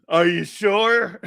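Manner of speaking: hammed-up teasing, loud and slow; drawn-out mock teasing. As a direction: stretch it out like a needling buddy. Retells friend's teasing question.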